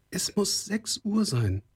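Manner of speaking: put-on very high, slow girl's voice; high, lingering maiden's voice; worried young girl. A narrator's reading. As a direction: draw it out high, uneasily.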